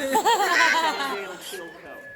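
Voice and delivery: loud, slow, savoring each syllable; slow mock announcement of the name; drawn-out name shout. Repeating the name for laughs.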